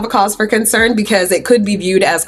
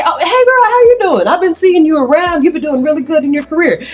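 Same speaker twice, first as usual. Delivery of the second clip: put-on much higher, bright girl-talk voice; high playful greeting; 8 semitones higher, 3 dB louder than usual. Jean greeting Jane on the flight, high and playful. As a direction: hype her up in a high voice. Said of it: "Jean say".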